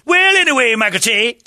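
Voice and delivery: hammed-up high Kenan voice; imitating Kenan Thompson. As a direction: squeak it out like a lazy sketch impression. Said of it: "He just go".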